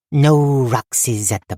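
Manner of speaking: slow, deliberate goblin voice, falling; sly goblin saying, slow and weighty; goblin riddle. A narrator's reading. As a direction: lay down a cryptic truth slowly. Goblin Zill speaks cryptically.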